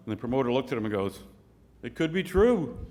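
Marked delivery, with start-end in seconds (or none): 1.8-2.8 high-pitched punchline, mock-earnest; hopeful protest. Promoter answers Saint Peter about his own lie, high and hopeful.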